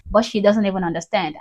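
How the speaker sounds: ordinary talk, nothing marked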